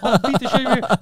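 loud, high-pitched angry outburst; going off angrily. Retold or imitated. Podcaster replaying his blow-up at old neighbour, furious. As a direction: re-enact blowing up, hard and loud. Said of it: "he just went off on him".

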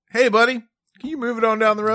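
high, loud imitation of a Southern Maryland accent; mock Southern accent. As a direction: echo his friend's Southern call. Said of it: "way more Southern than I intended".

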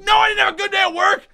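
irritated snap. Retold or imitated. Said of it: "did you have a good day".

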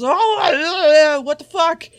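high, loud startled yelp; hammed-up loud surprise; shocked exclamation. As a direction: blurt it out in mock shock. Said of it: "everyone goes".

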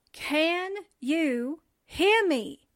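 slow, word-by-word, drawn-out question; exaggeratedly spaced-out repeat; insistent repeat. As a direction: spell it out slowly for someone not listening. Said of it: "I said".

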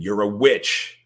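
loud high accusing shriek; mother screaming at daughter; mock scream. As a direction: hurl it at her like a madwoman. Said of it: "She begins screaming".

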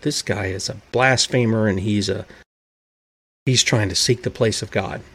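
low, hushed grumble among themselves; muttering under breath. Retold or imitated. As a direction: mutter it under your breath like a suspicious Pharisee. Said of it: "whispering under their breath".

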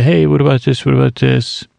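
usual speaking voice

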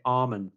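almond with a silent L. Shown as a demonstration. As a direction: say almond as "ah-mond". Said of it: "almond with no L".

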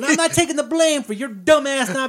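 high, hammed-up angry telling-off; mock scolding. Girl smacking a sleeping classmate awake, mock scolding.

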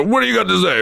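high-pitched mock old-timer challenge; imitated old-timer prodding a newcomer. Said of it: "and the old timer".

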